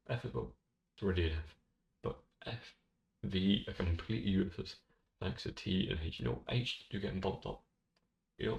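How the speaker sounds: ordinary talk, nothing marked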